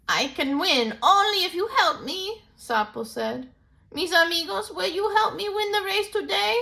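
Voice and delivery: high, eager plea; rally your friends; small toad pleading with friends; toad asking for help. Acted.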